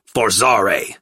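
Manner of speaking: snarled spell. A narrator's reading.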